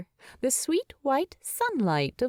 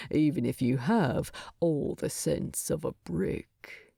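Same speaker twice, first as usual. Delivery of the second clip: low soft dwarf king; 3 semitones lower, 3 dB quieter than usual. Acted. Wounded Thorin fondly teasing Bilbo, low and soft. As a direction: tease him tenderly under your breath.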